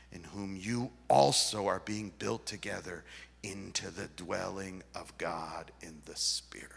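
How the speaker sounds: low, soft and reverent; quiet close to a Bible reading; hushed scripture reading. Acted. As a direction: let the passage sink to near whisper. Scripture reading drops to a hush.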